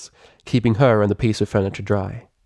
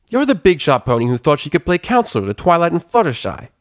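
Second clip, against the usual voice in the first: higher, accusing tomboy voice; Rainbow Dash, suspicious; 8 semitones higher, 3 dB louder than usual. Acted. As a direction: call her out with a sneer.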